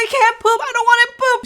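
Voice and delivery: high, distressed protest; hammed-up fearful whine; panicked whine. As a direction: whine high, half laughing at yourself. Retold fearful protest in labour.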